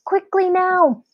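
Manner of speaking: high urgent hurry-up. A narrator's reading. Teacher hurries the children under desks. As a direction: push them to move fast.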